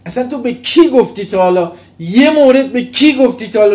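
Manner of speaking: loud, high, fervent preaching; preaching at full force. Acted. Preacher rebuking his congregation, loud and fervent. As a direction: hammer the point home to the crowd.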